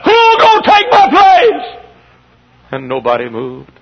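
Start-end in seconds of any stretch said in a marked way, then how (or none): 0.0-1.8 slow, very high, loud wail; imitated crying plea. Old missionary begging the crowd for a successor, acted slow wail. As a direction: cry it out as an acted appeal. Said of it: "he got to crying".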